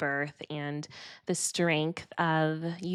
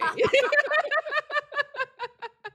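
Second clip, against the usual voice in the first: long laugh. Real, not acted. Host laughing.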